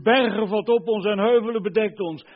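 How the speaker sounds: loud, high desperate call; crying out. Retold or imitated. Preacher voices the condemned begging for cover, crying out loudly. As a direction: cry out to the mountains for cover. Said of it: "Dan zullen ze roepen".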